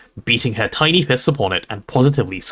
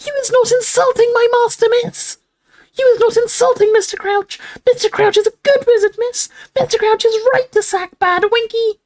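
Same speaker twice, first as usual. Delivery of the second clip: very high, outraged elf screech; 4 dB louder than usual. A narrator's reading. Winky defends Mister Crouch, outraged screech. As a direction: screech in defence of your master. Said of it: "and screeched".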